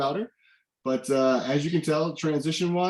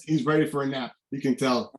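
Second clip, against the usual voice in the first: normal talking voice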